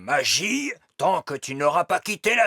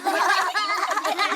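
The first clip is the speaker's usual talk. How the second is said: hearty laugh. Acted. Elf laughs at fairy's apology.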